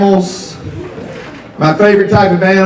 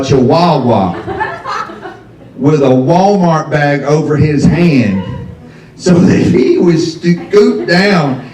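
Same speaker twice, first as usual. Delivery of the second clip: drawn out, slowed for effect; slow disbelief; slower than usual. Real, not acted. To the audience about his dad, slow and drawn out. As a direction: savor each word of the image, slowly.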